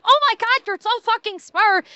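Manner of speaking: high, gushing fanboy squeal; mock gushing fan. Mocking fans praising a streamer, high and gushing. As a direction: gush like a starstruck fan.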